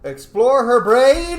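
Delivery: high, loud and slow rallying cry; excited command. Real, not acted. Player urging the mind probe, high, loud and slow. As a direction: call it out with big eager gusto.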